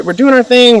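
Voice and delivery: normal talking voice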